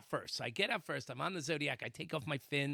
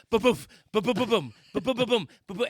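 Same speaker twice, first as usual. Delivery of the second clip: loud rhythmic booming, pitched up; imitated thumping; 5 semitones higher, 8 dB louder than usual. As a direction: pound out the thuds in quick bursts. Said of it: "getting louder and louder".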